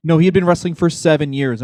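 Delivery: ordinary talk, nothing marked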